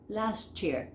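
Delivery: LAST YEAR linked as "lashchear"; one-word LAST YEAR with CH; native assimilation. Shown as a demonstration. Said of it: "Fíjense cómo se une todo".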